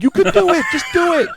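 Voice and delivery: very high, loud mock urging; hammed-up pleading pep talk; urgent encouragement. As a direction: egg him on at full pitch. Acted urging of neighbor.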